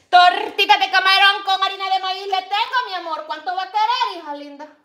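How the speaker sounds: loud, high vendor hawking; loud sing-song vendor cry; street vendor call. Acted. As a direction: sing it out like a market seller calling buyers. Calling out tortillas like vendor.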